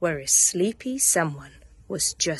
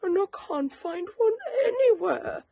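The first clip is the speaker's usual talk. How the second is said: plaintive; 4 dB louder than usual. Acted. Bear pleading.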